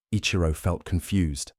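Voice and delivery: ordinary delivery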